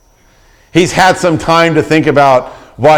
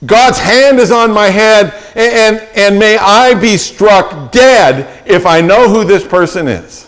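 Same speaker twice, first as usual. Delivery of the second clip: higher, louder vehement oath; swearing an oath; 6 semitones higher, 3 dB louder than usual. Retold or imitated. Peter swearing to the crowd: higher, louder vehement oath. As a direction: swear it like your life depends on it. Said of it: "he's in essence saying".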